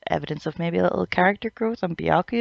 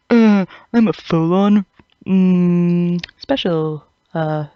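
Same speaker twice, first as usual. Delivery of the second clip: mock dumb brute; 6 dB louder, slower than usual. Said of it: "let's just jump in and go".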